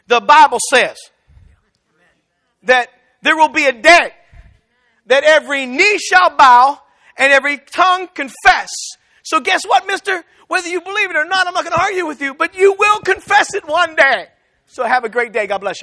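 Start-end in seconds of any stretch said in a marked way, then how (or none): 0.1-8.7 booming scripture, louder and slower; preaching loud. Retold or imitated. Quoting scripture at an arguer. 9.2-14.3 higher, pointed taunt to mister; sassy challenge. Retold or imitated. Taunting the arguer directly.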